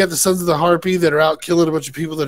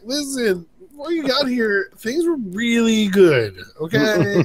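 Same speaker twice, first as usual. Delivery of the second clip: lightly voiced Tyrion, higher, with a laugh breaking in; mock Tyrion spin; 6 semitones higher than usual. Tyrion reassuring Dany amid explosions, a hint of mock salesman. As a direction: sell the lie with a nervous chuckle. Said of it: "Tyrion's kinda like".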